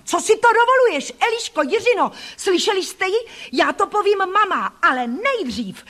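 put-on very high, outraged boy's tirade; high-pitched bratty fury; furious yelling. A narrator's reading. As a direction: shriek indignantly, calling witnesses. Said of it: "zařval".

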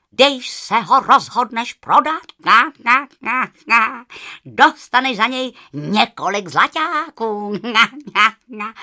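loud goose voice broken by honks; honking goose. A narrator's reading. Goose tells the girl to sell the feather, honking. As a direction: cackle between words like a goose.

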